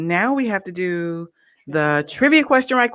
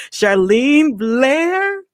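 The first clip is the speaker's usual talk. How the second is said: ordinary delivery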